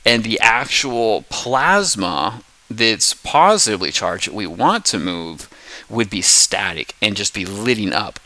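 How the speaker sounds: ordinary talk, nothing marked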